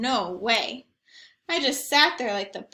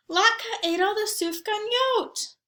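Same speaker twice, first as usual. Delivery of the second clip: lightly voiced girl, higher and tattling; tattling little girl; 9 semitones higher than usual. A narrator's reading. Zoe telling the family on Latka, lightly voiced tattle. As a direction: tell on the dog in a higher kid voice.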